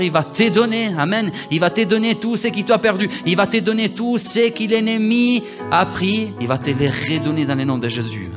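ordinary talk, nothing marked

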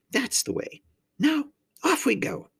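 high faun character voice; chivvying faun. A narrator's reading. Faun setting off with the girl, high and encouraging.

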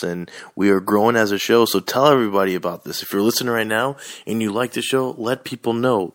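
loud energetic broadcaster voice; hyped radio host. Acted. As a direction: open the show big and loud. Podcast host opening the show.

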